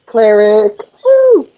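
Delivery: high, loud gleeful crow; triumphant cheer. Real, not acted. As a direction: whoop proudly at your own luck. Celebrates high wisdom bonus.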